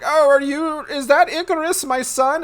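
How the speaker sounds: high cartoonish father voice; squeaky old Daedalus. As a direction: squeak it out like a doddering dad hoping to find his son.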